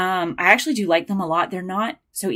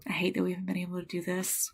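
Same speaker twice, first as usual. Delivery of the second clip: tearful whisper; 9 dB quieter than usual. Real, not acted. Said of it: "starting to possibly cry".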